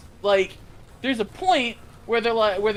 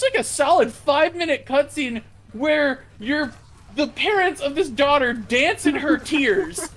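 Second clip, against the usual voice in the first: ordinary delivery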